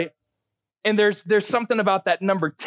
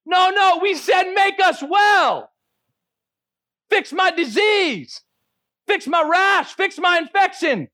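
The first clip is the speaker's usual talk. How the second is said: indignant protest; 10 semitones higher, slower than usual. Retold or imitated. Lepers protesting to Jesus that they asked for healing, exasperated shout. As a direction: push back, insisting they asked for healing.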